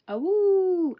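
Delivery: high, loud delighted whoop; excited whoop. Real, not acted. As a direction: cheer at the note. Excited over signed book note.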